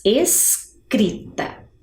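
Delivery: "Escrita" very slowly, with "es" set apart; separated syllables. Shown as a demonstration. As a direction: stretch "Escrita" as es-crita. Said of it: "faço o som de es". ("Escrita" devagar, separando o "es")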